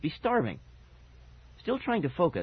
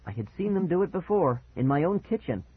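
ordinary delivery, nothing marked

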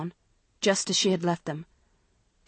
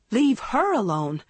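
higher, outraged echo with HER punched; wild incredulity; 5 semitones higher, 4 dB louder than usual. A narrator's reading. Girl echoing mother's order, wildly incredulous. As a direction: throw it back in wild disbelief. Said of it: "Maggie laughed wildly".

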